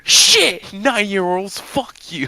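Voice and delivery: high, loud hammed-up outrage; mock angry rant. Ranting at imagined kids for laughs, mock angry. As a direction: yell abuse in playful fury.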